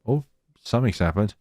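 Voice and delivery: ordinary talk, nothing marked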